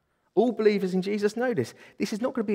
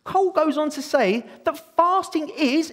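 usual speaking voice

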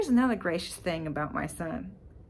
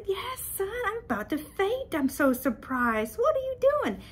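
mock surprise; 12 semitones higher than usual. Mom's playful mock surprise to son.

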